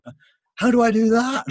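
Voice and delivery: very high, loud comic cry; hammed-up helpless yelp; desperate wail. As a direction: wail the question in mock panic. Helpless retold question to crew.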